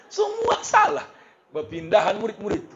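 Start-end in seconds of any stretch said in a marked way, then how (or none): 0.0-1.1 very high, slow laughing cry; comic laughing lament. Real, not acted. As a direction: laugh it out in despair. Laughing at the dilemma.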